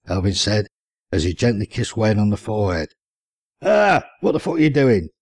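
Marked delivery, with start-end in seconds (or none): 3.6-5.1 higher, startled outcry; cried out. Acted. Wayne waking to Elvin's kiss, startled outcry. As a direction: jolt awake and yell at him. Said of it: "Wayne cried out".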